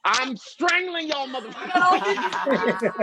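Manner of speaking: slow, high mock threat; comic fury. Comic threatening friends hoarding quarters, hammed-up fury. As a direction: draw out a hammed-up furious threat.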